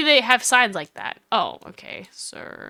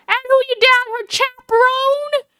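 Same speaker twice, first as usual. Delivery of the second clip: very high Southern twang; Southern drawl; 12 semitones higher, 3 dB louder than usual. Acted. Woman demands to know who the companion is, Southern drawl. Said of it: "I've given her the one with the drawl".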